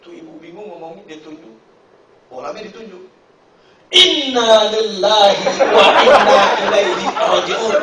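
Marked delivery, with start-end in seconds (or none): 4.0-7.8 slow, loud drawn-out mourning chant; solemn mock lament. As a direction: intone it like a funeral prayer. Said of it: "Dia ingat".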